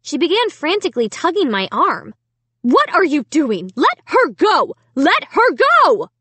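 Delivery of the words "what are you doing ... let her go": high, emphatic yelling, each word punched; slow, hard-hit frantic shouts; frantic mother crying out. A narrator's reading. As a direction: demand it in a panic. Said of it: "Alicia's mother cried".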